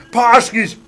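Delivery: punchy exclaimed "Poxkies"; sharp mock-triumphant cry; movie catchphrase. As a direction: call it out like a film line. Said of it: "I say that in my movie".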